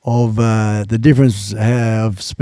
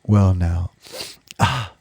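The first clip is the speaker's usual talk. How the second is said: usual speaking voice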